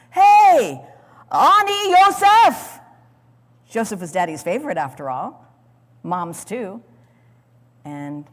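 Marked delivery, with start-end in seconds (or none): 0.1-2.8 high, loud, slow indignant cry; indignant scream. Pretend. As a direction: scream it up out of the pit. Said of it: "screamed in shock and indignance".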